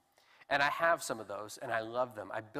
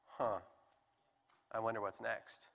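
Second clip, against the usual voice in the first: low, much softer musing; deflated let-down; 5 semitones lower, 8 dB quieter than usual. Acted. You got there and it left you empty, quietly deflated. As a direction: trail into an underwhelmed, quiet wondering.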